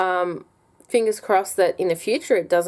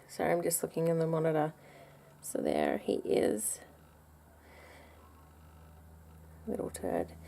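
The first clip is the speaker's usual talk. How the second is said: quiet aside; 13 dB quieter than usual. Real, not acted. Mother looking in the baby monitor, quiet aside. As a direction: drop right down, half to yourself.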